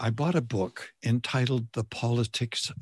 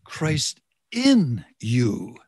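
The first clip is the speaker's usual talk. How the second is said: "in" drawn out and louder, slow phrase; stress on "in"; 5 dB louder, much slower than usual. Shown as a demonstration. Preacher emphasizing the second word for the congregation, stress on "in". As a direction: lean hard and long on "in". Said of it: "I want to emphasize the second word".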